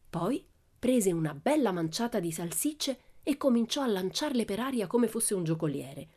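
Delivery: ordinary delivery, nothing marked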